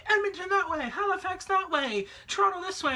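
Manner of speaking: high-pitched calls of airport staff; imitated yelling. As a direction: call out directions like harried airport staff. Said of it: "yelling at everybody".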